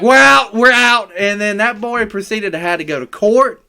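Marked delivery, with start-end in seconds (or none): 0.0-1.1 loud, high hurried bailing out; loud alarmed retreat; panicked exit. Retold or imitated. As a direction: shout it as you flee. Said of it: "We're like".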